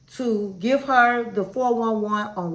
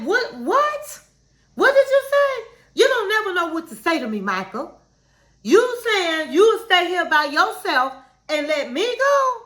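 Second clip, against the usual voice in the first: imitating a high, indignant outburst; mock blowup, pitched high and fast; went off; 5 semitones higher than usual. Wife blowing up at Michael for staying behind, mock shrieking high. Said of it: "Angela went off".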